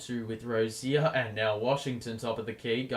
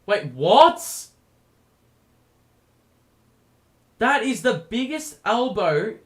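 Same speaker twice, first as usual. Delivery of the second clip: high, loud, incredulous outburst; can't believe the elbow; outraged disbelief; 7 semitones higher, 6 dB louder than usual. Real, not acted.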